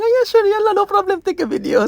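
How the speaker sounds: high, bright mock coworker voice, light and eager; high cheerful coworker. Coworker cheerfully agrees to be filmed, high and eager. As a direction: chirp it like an eager friend.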